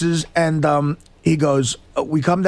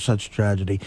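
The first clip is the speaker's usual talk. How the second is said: put-on low, soft, solemn German accent; 9 semitones lower, 5 dB quieter than usual. German guide ending his solemn moment, low German accent. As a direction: murmur it as a dutifully mournful German guide. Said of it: "that German accent is just really".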